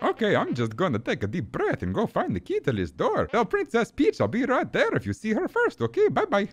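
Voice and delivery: high cartoon Italian brother voice; high-pitched, excited game sidekick; Luigi, eager. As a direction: chatter eagerly as a cartoon plumber.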